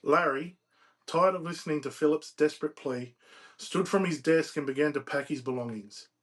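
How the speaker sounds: measured, emphatic read-aloud narration; storyteller reading from a novel; story narration. A narrator's reading. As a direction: read the tale out phrase by phrase. Reads novel excerpt aloud.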